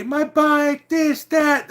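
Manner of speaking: high, loud mock drunken wail; hammed-up drunk yelling about his bike; loud imitated drunk complaint; drunk biker's cry. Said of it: "They're everybody".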